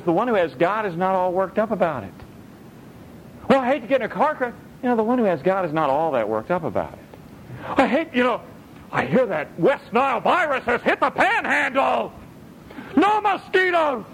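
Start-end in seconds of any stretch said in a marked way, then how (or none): 3.5-4.5 higher, fast mock fretting; hammed-up anxious voice; mock worrier. 7.8-14.0 hammed-up frightened voice; panicky worrier. Mock of a panicky unbeliever.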